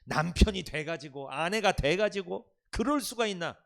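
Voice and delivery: loud, slow, reproachful accusation; hammed-up spouse nagging; mock accusing scold. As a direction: scold like a spouse keeping score.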